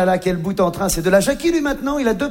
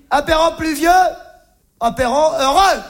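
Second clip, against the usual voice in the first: higher sing-song saying; quoting Jackie; 8 semitones higher, 4 dB louder than usual. Retold or imitated. Jackie reciting another drinking motto, sing-song. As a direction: recite the rhyme proudly. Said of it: "il disait aussi".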